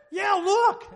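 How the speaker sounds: loud, high, hammed-up insistence; emphatic shouted reply. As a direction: shout it back to the disbeliever. Companion insists, answering his shout.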